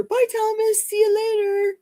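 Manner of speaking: bright high-pitched sing-song "Bye, Thomas. See you later."; sing-song goodbye. Real, not acted. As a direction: call the goodbye up high and cheery.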